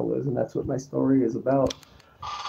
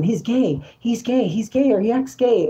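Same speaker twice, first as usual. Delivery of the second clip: high mimicked chant of accusers; accusing gossips; 8 semitones higher than usual. People around him accusing him, high mocking imitation. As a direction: imitate people repeating a taunt in a higher voice. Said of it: "You hear people around me saying".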